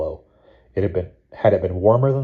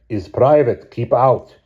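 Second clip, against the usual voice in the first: put-on heavy Russian accent, loud and curt; 5 semitones higher, 6 dB louder, slower than usual. A narrator's reading. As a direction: bark it in thick Russian English. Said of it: "He said again".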